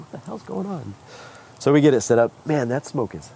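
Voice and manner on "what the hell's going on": higher, hushed what-the-hell; puzzled onlookers muttering, pitched up and soft; baffled bystanders. Retold or imitated. Guests at the event reacting to the honking, hushed and puzzled. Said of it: "people are like".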